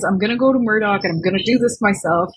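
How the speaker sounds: normal talking voice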